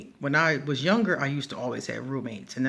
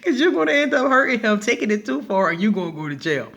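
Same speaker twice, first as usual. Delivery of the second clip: much higher and louder, still laughing; roommate warning; 7 semitones higher, 7 dB louder than usual. Retold or imitated. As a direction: squeal out the warning. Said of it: "And she was like".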